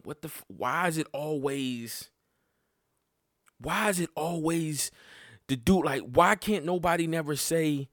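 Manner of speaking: mock outraged pleading at his shorty; exasperated retold argument; retold angry rant. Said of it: "and I'm mad".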